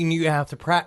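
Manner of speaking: ordinary delivery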